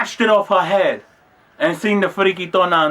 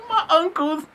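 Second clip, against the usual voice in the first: high, laughing through the words; cracking up; 5 dB quieter than usual. Real, not acted. Cracking up at the memory of the uncle, laughing. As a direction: dissolve into real laughter. Cracks up at his own story.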